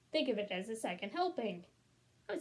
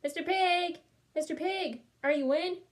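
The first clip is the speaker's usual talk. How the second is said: higher, louder call at the door; 6 semitones higher, 4 dB louder than usual. Acted. Wolf at the second pig's house, calling out. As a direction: call for the neighbor to answer. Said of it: "I called".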